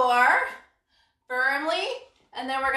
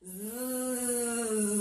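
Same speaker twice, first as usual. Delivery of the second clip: soft fly buzz, much softer; buzzing fly zzz; 3 semitones lower, 10 dB quieter than usual. Shown as a demonstration. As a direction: hum a quiet buzzing fly. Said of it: "give ourselves a zzz".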